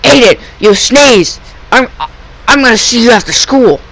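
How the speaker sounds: a hint of a fed-up teacher threatening detention; imitating angry teacher. Teacher scolds Aiden over sneeze.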